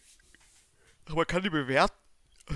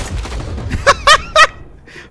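loud high nervous laughter; high excited laugh; 8 dB louder than usual. Real, not acted. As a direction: burst out laughing from tension. Laughs in tense moment.

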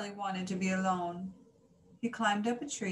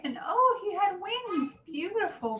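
high, loud delighted gasp; amazed; 12 semitones higher, 5 dB louder than usual. A narrator's reading. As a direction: marvel at the wings. Discovers his wings.

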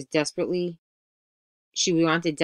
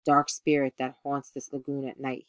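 ordinary delivery

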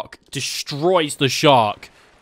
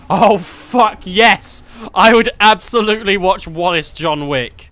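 thrilled; 6 semitones higher, 7 dB louder than usual. Real, not acted. Loving the Wallace idea.

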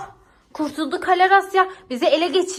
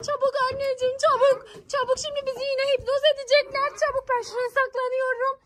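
high, frantic urging to hide; panicked; 9 semitones higher than usual. Acted. Mother panics, everyone must hide.

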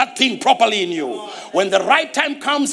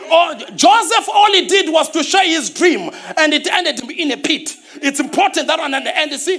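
impassioned preaching; 7 semitones higher than usual. Real, not acted.

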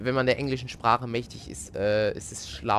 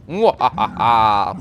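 slow, booming villain cackle; evil laugh; 10 dB louder, much slower than usual. Pretend.

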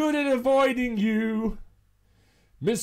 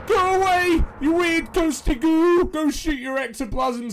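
high, loud frightened shout; panicked shooing; 7 semitones higher, 3 dB louder than usual. Real, not acted. Player chased by the ghost, yelling in panic. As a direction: yell at the ghost to leave, scared.

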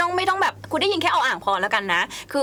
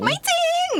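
high-pitched denial. Real, not acted. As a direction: squeak it out much higher. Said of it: "คนโกหกมักขึ้นเสียงสูงนะ".

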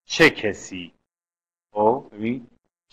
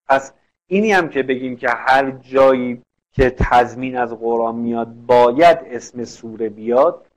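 ordinary talk

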